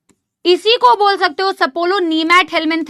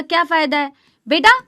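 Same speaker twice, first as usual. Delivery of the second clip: ordinary talk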